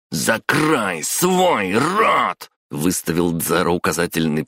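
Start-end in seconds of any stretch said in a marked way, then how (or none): 0.1-2.5 very slow, high-pitched command; drawn-out, outraged silencing; furious order. A narrator's reading. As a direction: stretch each word in anger.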